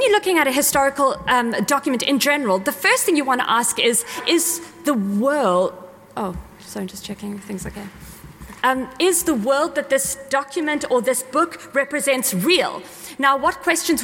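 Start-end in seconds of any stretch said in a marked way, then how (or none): 6.2-7.9 soft, low-key muttered apology; murmured to oneself while checking something; quiet aside. Real, not acted. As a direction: drop the volume right down for a quick aside. Breaks off to check equipment.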